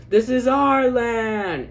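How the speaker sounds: slow, high, loud shout of triumph; retold yell. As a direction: belt it out like claiming the land. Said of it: "Sam yells".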